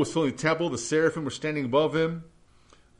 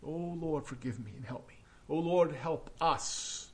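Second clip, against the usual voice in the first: whispered prayer; 3 semitones lower, 9 dB quieter than usual. Real, not acted. Pastor praying quietly to God mid-sermon, whispered. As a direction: breathe the plea to God.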